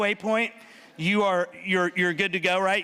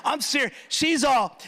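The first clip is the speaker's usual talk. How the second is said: ordinary delivery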